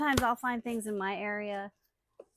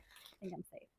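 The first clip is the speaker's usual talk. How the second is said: whispered aside; 4 semitones lower, 17 dB quieter than usual. Real, not acted. Aside while unboxing.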